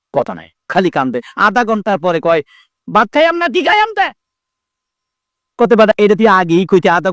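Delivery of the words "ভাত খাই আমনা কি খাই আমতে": put-on crying child's answer. Child finally answers after crying.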